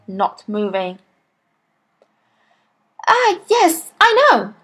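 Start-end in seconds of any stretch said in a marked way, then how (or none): 3.1-4.5 put-on high, excited little boy; eager schoolboy. A narrator's reading. Boy thinks he has the answer, eager. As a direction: pipe up high with sudden confidence.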